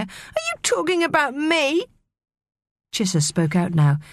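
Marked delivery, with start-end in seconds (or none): 0.0-1.9 high, indignant squeal without volume; mock scream of outrage. A narrator's reading. Maria challenges the young mermaid's insult, squealing indignantly. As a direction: pitch it high, affronted. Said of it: "screamed Maria".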